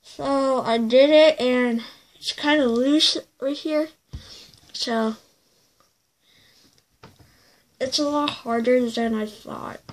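lips held apart by guard; speaking with mouthguard in. Real, not acted. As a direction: teeth covered, muffled consonants. Seen 0: Green mouthguard clearly in his mouth throughout; removed by 10.8.

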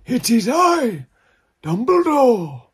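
high, slow, pompous proclamation; slow hammed-up Dumbledore voice; grand old wizard. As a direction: announce yourself like an ancient wizard. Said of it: "Don't go".

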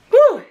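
high sharp exhale whew; burning mouth whew. Real, not acted. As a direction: blow out the burn, loud and high. Exhale after hot chili bite.

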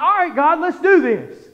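loud, high, charged-up shout; acted gung-ho eagerness. Preacher replaying his answer to God, loud gung-ho eagerness. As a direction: charge in ready for action. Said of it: "all of a sudden I'm like".

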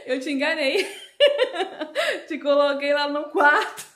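loud, high, triumphant laughter in the voice; laughing gleefully. Real, not acted. Mother telling daughter she tricked her, laughing gleefully. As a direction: crow it with real laughs between phrases.

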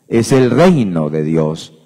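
normal talking voice

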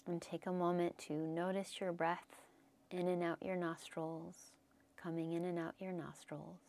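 soft, settling meditation voice with long pauses; guided meditation. Real, not acted. Yoga teacher settling the class to notice breath, guided meditation.

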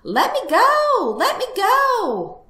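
very high, loud, drawn-out wail; shrieked plea, slow and stretched; squealing in protest. Acted. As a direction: squeal it like a struggling piglet. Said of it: "The piglet kicked and squealed".

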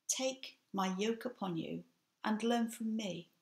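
usual speaking voice

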